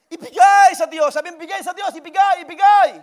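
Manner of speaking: high and loud, pressing the words; fervent urging. Real, not acted. Pastor leading the church to repeat, loud fervent urging. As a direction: urge them loudly to let go.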